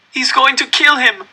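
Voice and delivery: high, loud frantic plea; pleading. A narrator's reading. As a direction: beg an adult to stop it. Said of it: "I pleaded with the man".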